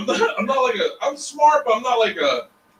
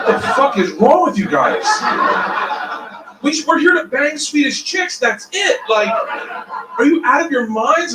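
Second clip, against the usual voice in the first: incredulous outburst; 7 semitones higher than usual. Retold or imitated. Mocking friends' nerdy plans.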